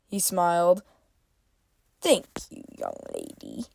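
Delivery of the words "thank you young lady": chuckling shopkeeper. A narrator's reading. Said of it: "Irno chuckled".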